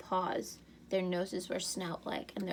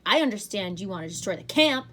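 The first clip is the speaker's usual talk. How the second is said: louder, heated accusation; yelling; 8 dB louder than usual. A narrator's reading. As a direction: yell it at him across the room. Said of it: "she yelled".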